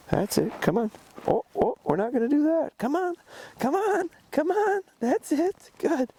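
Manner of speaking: very high, eager calling to the dog; high coaxing call. Real, not acted. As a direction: coax her back with a high sing-song.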